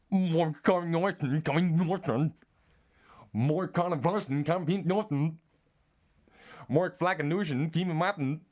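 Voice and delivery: high, loud, garbled put-on voice; hammed-up American catchphrase.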